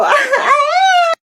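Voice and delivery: shrieking laugh; big high laugh. Acted. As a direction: cackle at your own joke. Laughs at own pickup line.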